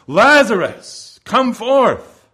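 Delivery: high, loud, slow calling out across a tomb; Jesus summoning a dead man from the grave, a loud high call; loud cry. Acted. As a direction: cry out the name, then the command. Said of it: "he cried with a loud voice".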